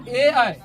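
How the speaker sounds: ए आई called out loud and clear; loud teacher demo of the vowels ए आई. Shown as a demonstration. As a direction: say ए आई out loud for the class. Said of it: "जैसे".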